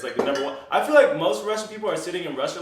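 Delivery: ordinary talk, nothing marked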